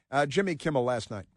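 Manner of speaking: ordinary talk, nothing marked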